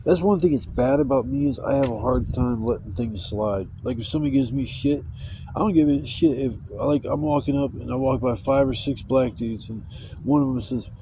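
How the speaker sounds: normal talking voice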